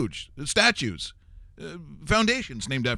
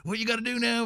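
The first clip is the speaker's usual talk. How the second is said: lightly higher manic cackling Busey; a loopy hint of Gary Busey; a mild Gary Busey ho-ho riff; Gary Busey impression; 6 semitones higher than usual. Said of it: "Gary Busey is he's going".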